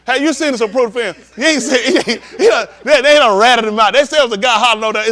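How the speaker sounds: loud, excited teasing shout. Real, not acted. Teasing a friend loudly about the Zapruder film. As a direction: call it out loud while laughing.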